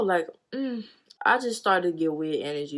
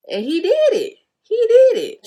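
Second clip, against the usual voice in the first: shocked; 11 dB louder than usual. Real, not acted. Shocked retelling.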